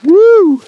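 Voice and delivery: loud high whoop from the cold. Real, not acted. Cold snow down neck.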